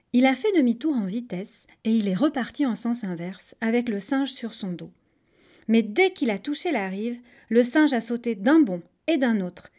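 usual speaking voice

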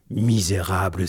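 ordinary delivery, nothing marked

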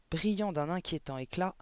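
ordinary delivery

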